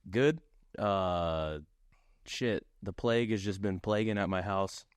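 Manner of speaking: normal talking voice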